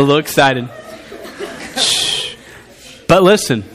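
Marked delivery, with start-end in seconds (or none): 1.8-2.3 soft drawn-out shush; quiet the room with a shush; hushing shh. Real, not acted. Preacher settling a laughing congregation, soft shush.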